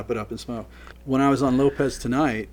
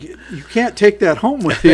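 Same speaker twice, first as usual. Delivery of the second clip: raised, higher retold protest; mock protest; 9 semitones higher, 12 dB louder than usual. Crew member stopping guests at the gates, mock protest. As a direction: call it out loudly, half amused. Catching guests taking band equipment.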